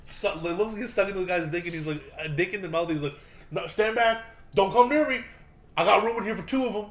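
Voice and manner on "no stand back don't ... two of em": mock warning shout. Trainee threatening attackers, high-pitched mock warning. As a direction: imitate warding people off in a raised pitch. Acted trainee warding people off.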